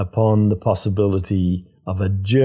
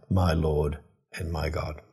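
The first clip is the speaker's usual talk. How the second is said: hushed confession; 4 semitones lower, 7 dB quieter than usual. Acted. Thomas's confession repeated.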